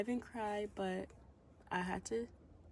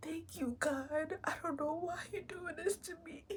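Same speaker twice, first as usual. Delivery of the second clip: high, shaky crying voice; tearful; 10 semitones higher, 4 dB quieter than usual. Real, not acted. She cries and asks God why this happens. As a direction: let the words crack with real tears.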